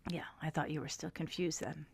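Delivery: a soft, teasing aside in a whisper. Real, not acted. Host teasing his co-host about the movie, soft whispered jab. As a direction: breathe it like a sly joke.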